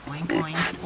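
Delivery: lightly imitated low oink; pig oink. Mother echoing her child's pig noise, light oink. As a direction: give a small, low piggy oink. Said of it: "what sound does a piggy make?".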